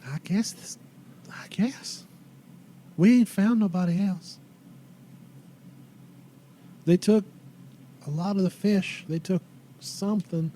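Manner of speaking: put-on subdued, halting old lady; old townswoman.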